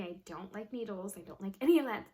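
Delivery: ordinary talk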